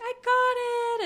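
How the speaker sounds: very high, slow squeal of triumph; thrilled squeal. Retold or imitated. Davy finding her name on the cast list, squealing with joy. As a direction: squeal it like you just won.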